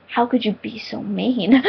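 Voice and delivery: slow, soft, wounded act; mock hurt reproach. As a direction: plead quietly as if betrayed. Acted hurt reproach to dad.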